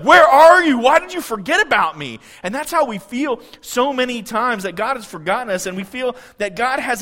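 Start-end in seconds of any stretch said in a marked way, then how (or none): 0.0-2.2 loud, high-pitched plea to God; mock desperate outcry. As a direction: cry out as if suddenly abandoned. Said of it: "six seconds later he's like".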